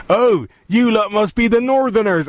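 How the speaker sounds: put-on high, slow old West Country man; old local voice. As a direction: mimic the old press-room regulars. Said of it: "Turn around and goes".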